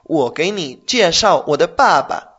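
wo gei ni jieshao wo de mama slow and clear; slow example. Shown as a demonstration. Teacher gives a slow example sentence. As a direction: read the example slowly. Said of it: "Por ejemplo".

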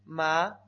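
"ma" rising, firm and loud; second tone. Shown as a demonstration. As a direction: lift "ma" upward like a question. ("ma" en segundo tono, ascendente)

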